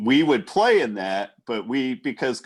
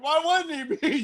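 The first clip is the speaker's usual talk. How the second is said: high-pitched mock retort; incredulous comeback; 13 semitones higher than usual. [name] answering for the hit player, high mock retort. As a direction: toss it back in a high voice.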